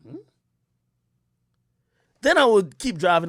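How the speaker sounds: ordinary delivery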